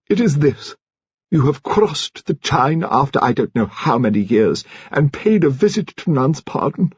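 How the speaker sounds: put-on an elderly aristocrat laying down the family standards; grand old lady. A narrator's reading. She makes amends to Finn.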